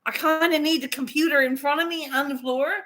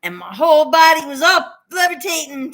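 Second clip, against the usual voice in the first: ordinary delivery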